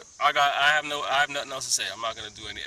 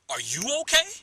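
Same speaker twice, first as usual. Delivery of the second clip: a high, louder imitation of the question; mock concern; 10 semitones higher, 8 dB louder than usual. Retelling the man's question, high and mocking. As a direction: pitch it up and push it out. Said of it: "Just like that".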